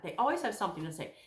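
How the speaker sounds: ordinary talk, nothing marked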